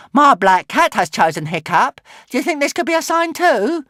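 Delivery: high, loud character voice; Barbara the Barbarian. A narrator's reading. Barbarian woman points out her cat's choice, loud and high. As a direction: announce it loudly, rising very high. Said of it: "said Barbara the Barbarian".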